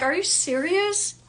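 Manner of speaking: high, slow disbelieving question; can't believe what she hears; mock shocked outrage; incredulous. Disbelief at the doctor.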